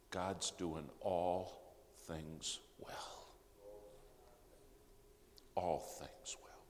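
slow, soft and tender, fading to a whisper; a mother calming her son, barely breathing the repeat; slow gentle reassurance. Retold or imitated. Mother reassures her son, slow and tender.

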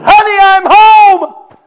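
very high, loud, slow joyful call home; husband bursting in joyfully; healed husband's cry. Acted. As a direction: shout home in delight. Husband voiced in story.

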